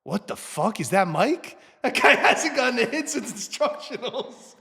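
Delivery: amazed onlookers. Retold or imitated. Teammates shocked at his home run, laughing disbelief. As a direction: gasp it out, half laughing in disbelief. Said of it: "everyone's like".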